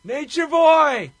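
drawn-out, high, full-voiced yell; Sting yelling. Wrestler calling out from a submission hold, loud high yell. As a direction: holler it loud and high, stretching it out. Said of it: "and he yells".

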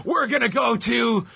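high, drawn-out mock announcer throw; stupid announcer voice. Mocking an announcer's throw to the women, silly announcer voice. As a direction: stretch it high like a hammed-up commentator. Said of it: "last word had this stupid announcer".

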